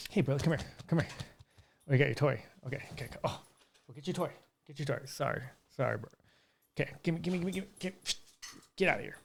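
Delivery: soft playful chat with the dog; quiet talking to dog. Real, not acted. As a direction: murmur to the dog while playing. Playing with his dog Broly.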